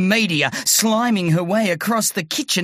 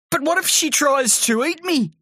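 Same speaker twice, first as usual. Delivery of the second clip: put-on scared boy; 10 semitones higher, faster than usual. A narrator's reading. Terry scared.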